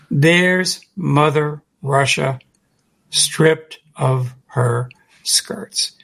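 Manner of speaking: drawn out, each word set apart; slow and emphatic. Retold or imitated. Speaker repeating the quote so it sinks in, slow and emphatic. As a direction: repeat the quote very slowly and deliberately. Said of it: "say it real slowly and emphatically".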